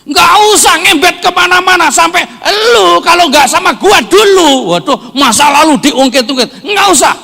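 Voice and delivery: very high, full-force shouting; shouted preaching. Real, not acted. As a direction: yell the warning to the congregation. Shouted climax of sermon.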